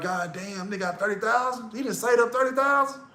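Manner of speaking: hushed, high-pitched disbelief; mock amazement. Friend reacting to a buddy's savings, mock amazed. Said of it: "make you feel bad".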